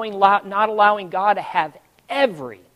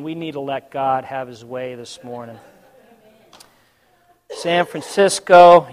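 ordinary delivery

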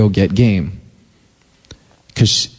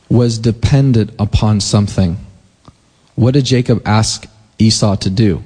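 ordinary delivery

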